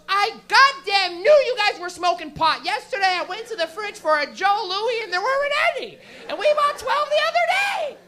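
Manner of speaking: high, loud scolding mom voice; Canadian mom scolding the teenagers about the food. Retold or imitated. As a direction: scold the boys over the missing snacks. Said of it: "she goes".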